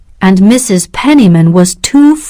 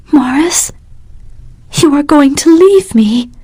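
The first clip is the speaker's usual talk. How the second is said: murmuring; 6 semitones higher than usual. A narrator's reading. Catherine murmuring to Morris at the door. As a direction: murmur it close to him.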